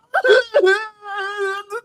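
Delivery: hammed-up high fake wail; mock sob. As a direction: fake a sob over a lost puppy. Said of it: "and I was like".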